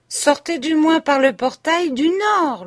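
high, loud call across the square; shouted. A narrator's reading. The Swiss shouts to the couple from the threshold. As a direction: shout after the departing pair. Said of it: "leur cria le Suisse".

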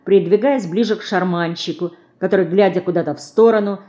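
ordinary delivery, nothing marked

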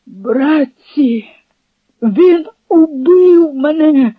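high, slow anguished cry; dying cry. A narrator's reading. The shot Cossack cries to his brothers in anguish.

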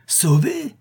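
high-pitched questioning cry; sharp rising outcry; incredulous exclamation. A narrator's reading. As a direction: exclaim it in disbelief. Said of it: "s'écria Pique-Vinaigre".

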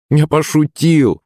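high, drawn-out playful line; smiling. A narrator's reading. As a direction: stretch it out with a smile. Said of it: "улыбнулся я".

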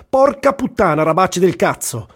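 angry shout at the fumbling Iraqi porters; yelling in Italian. Retold or imitated. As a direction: bellow the curses at the workers. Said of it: "comincio a urlare in italiano".

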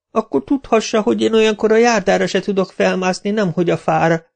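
high, whiny protesting voice; lackey Kapa whining at his boss. A narrator's reading. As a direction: whine it like a put-upon drunk. Kapa's voice.

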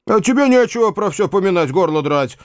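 high-pitched mild scolding; reproachful. A narrator's reading. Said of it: "продолжал дядя с укоризной".

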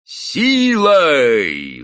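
long howl, high and falling; воет. A narrator's reading. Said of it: "выл заросший мужик". (протяжный вой)

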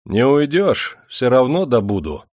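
high, loud confident call; calling after her. A narrator's reading. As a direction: call after someone fleeing. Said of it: "спокойно крикнул вдогонку Никита".

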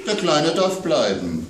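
put-on child's voice worried about monsters at bedtime; little girl. A narrator's reading. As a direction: play the small daughter scared of monsters. Child Amanda pleads.

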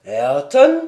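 loud, rising astonished question; startled cry. Acted. Glenarvan shocked to hear of Ayrton aboard. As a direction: shout the name in disbelief. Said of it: "s'écria Glenarvan".

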